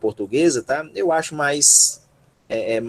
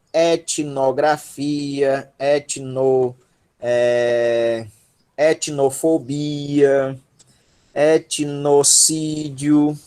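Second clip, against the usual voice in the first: slow deliberate; much slower than usual. Shown as a demonstration. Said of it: "teacher spells out examples".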